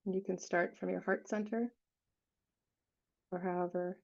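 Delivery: unhurried meditation guide, long silences between phrases; guided meditation. Real, not acted. Leading listeners to relax and feel their hearts, guided meditation voice. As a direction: guide them gently, leaving space after each phrase.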